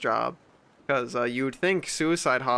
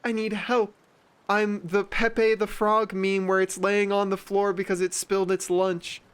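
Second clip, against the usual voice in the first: higher, whiny, overdramatic victim; mock self-pity; 6 semitones higher than usual. Mocking attention seekers, high whiny self-pity. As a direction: whine like a drama queen. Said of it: "they're like".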